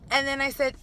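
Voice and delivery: usual speaking voice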